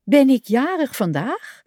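high, astonished question with rising end; verbaasd. A narrator's reading. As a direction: ask in amazed disbelief, pitch up. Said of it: "zei Kikker verbaasd". (verbaasde, hoge vraag)

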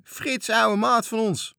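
high, loud, slow greeting; joyful shout. Acted. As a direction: hail an old friend with joy. Sailors greet old friend. (matrozen begroeten blij hun ouwe maat)

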